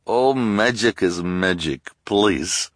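put-on high, loud, slow foreign accent; Madame Lulu's strange accent. A narrator's reading. Fortune-teller promises Olaf he will prevail, in a strange accent. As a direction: draw it out in a thick foreign accent. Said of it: "said in her strange accent".

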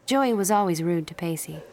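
ordinary delivery

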